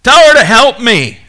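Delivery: high, loud demanding cry; demanding outburst. Acted. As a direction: shout it like an overworked, bossy sister. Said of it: "She's demanding".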